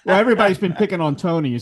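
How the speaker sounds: loud, high, laughing as you speak; amused banter. Real, not acted. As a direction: join the teasing through laughter. Joining the teasing while laughing.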